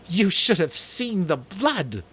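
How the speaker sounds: high, slow, savoured exclamation; gleeful boast. Acted. Jack spreads his arms to the hunters, gleeful. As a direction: relish every word of it.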